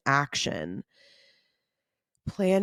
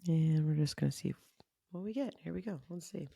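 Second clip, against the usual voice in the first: quiet murmur; 8 dB quieter than usual. Real, not acted. Quietly pulling a card for herself.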